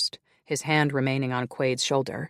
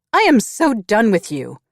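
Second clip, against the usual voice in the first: high, loud, fed up; furious exit; 8 semitones higher, 9 dB louder than usual. Acted. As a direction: snap it and storm off shouting. Grace storms out.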